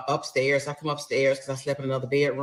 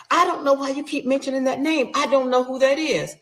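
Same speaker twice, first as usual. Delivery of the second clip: higher pleading husband voice; mock husband denial; 10 semitones higher, 3 dB louder than usual. Husband lying to his wife about the other woman. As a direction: play the cheating husband playing dumb.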